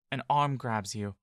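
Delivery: plain reading voice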